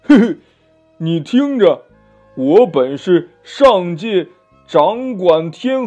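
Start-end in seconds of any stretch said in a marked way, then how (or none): 0.0-0.4 loud, high sneering chuckle; cold laugh. A narrator's reading. Demon answering the Monkey King's challenge, cold laugh. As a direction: scoff with a short mock laugh. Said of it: "那妖怪冷笑一声".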